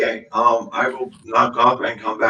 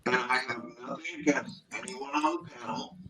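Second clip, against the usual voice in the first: mic fading out, far and muffled; inside a barrel; 13 dB quieter than usual. Real, not acted. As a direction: voice dropping away from the mic. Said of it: "it's getting difficult to understand you".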